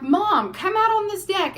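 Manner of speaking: mock mother calling from deck; imitating mom calling. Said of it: "She's like".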